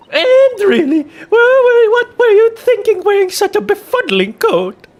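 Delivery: very high, loud, flustered cry; high stammering outrage; exasperated shriek. Acted. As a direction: shriek at the old man in disbelief. Juror scolds old man, exasperated.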